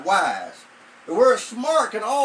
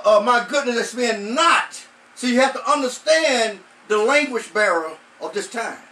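rising, louder preacher's cadence; preaching; 3 semitones higher, 6 dB louder than usual. Acted. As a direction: drive the verse home to the congregation.

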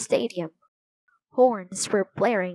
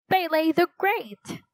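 high, celebratory cry; cheering; 8 semitones higher than usual. A narrator's reading. Roberto cheering Brazil's win, excited. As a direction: cheer for a hero.

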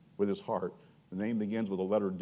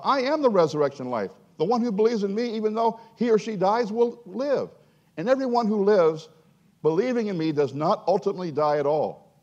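voicing Jesus; 9 semitones higher, 6 dB louder than usual. Preacher voicing Jesus to Martha, high and loud. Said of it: "And he's saying".

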